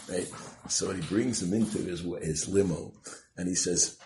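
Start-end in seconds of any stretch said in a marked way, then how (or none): none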